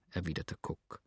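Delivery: plain reading voice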